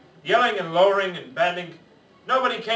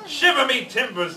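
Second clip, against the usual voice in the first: higher, louder pirate oath; pirate exclamation; 8 semitones higher, 4 dB louder than usual. Acted. Pirate reacts to being lost, loud pirate oath. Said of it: "the pirate said".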